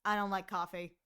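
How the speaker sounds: no emotion, straight face; deadpan brush-off of a pickup line; quiet deadpan. Retold or imitated. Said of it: "Kaede's quiet deadpan, like, straight face, no emotion".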